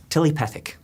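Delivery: Telepathic said as tilipethic, Kiwi vowels; New Zealand accent. Retold or imitated. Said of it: "Peter Jackson try to say".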